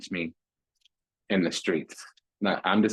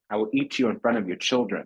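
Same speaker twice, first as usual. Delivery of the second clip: ordinary delivery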